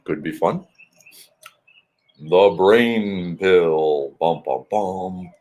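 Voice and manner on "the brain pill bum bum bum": slow, low dramatic announcement; dramatic announcer. Pretend. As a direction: announce it like a movie trailer.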